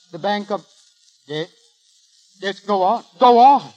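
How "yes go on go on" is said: rising, louder eager go on; excited urging. Acted. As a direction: press them to keep talking, more and more eager. Eager caller urging on phone news.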